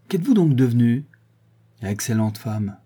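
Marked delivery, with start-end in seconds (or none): 0.1-1.0 louder, calling across the garden; calling from afar. Acted. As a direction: call out to someone far away. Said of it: "cria-t-elle de loin".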